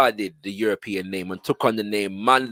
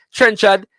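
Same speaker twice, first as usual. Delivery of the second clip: Trenchard said high and loud; Trenchard held up; 8 semitones higher, 5 dB louder than usual. Shown as a demonstration. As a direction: pronounce Trenchard pointedly for comparison. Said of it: "it like rhythm".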